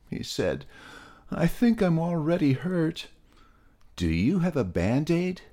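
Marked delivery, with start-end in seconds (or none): 1.3-5.4 high plaintive little-pig voice; a sore pig asking for help; high, whiny young pig; Peter the pig. Acted. Peter the hurt pig speaks.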